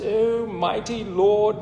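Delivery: slow, grand, mock-formal prayer voice; posh prayer. Preacher mocking showy prayers God doesn't care about, mock-grand and slow. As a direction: ham up an ornate prayer, drawn out. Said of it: "all these posh words".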